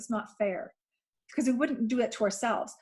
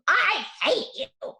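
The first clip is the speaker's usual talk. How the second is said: higher, louder, slow shouted outburst; angry child yell; 9 semitones higher, 5 dB louder, much slower than usual. Retold or imitated. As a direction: yell it like a furious kid. Daughter yelling back through door.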